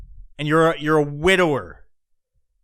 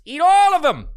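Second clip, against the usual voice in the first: much higher, louder outburst; shouted curse; 10 semitones higher, 6 dB louder than usual. Real, not acted. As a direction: explode on the repeat. Ranting at TikTok dancers.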